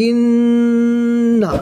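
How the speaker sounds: ইন্না with the nun held long through the nose; nasal ghunna. Shown as a demonstration. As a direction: stretch the ن of ইন্না for a full alif of ghunna. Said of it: "নুনটা শুধু গুণনা হবে".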